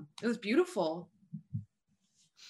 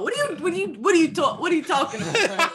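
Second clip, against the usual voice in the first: high, fake Boston accent; 8 semitones higher, faster than usual. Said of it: "your fake Boston accent".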